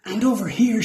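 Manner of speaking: high call. A narrator's reading. Dashi calling the crew over to the goo, high call. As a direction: call across the room, pitched high. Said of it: "she called".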